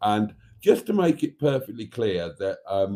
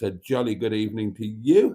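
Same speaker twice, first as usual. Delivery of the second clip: ordinary talk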